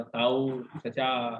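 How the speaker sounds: low, slow lightly imitated Delhi-side address; a light hint of the Delhi-side way of saying chacha, low and slow. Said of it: "जाने वाला tone डालने की कोशिश".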